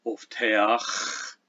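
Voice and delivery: put-on Old English teach with a drawn-out velar fricative; velar fricative ending. Shown as a demonstration. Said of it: "sounds we no longer make".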